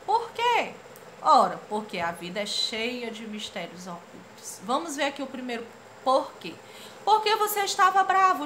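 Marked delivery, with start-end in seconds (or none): 0.1-0.7 high question. Acted. Character asks why. 7.0-8.4 put-on high child voice. Rereading the child's line.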